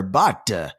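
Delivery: usual speaking voice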